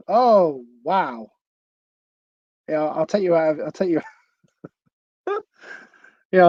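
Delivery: ordinary talk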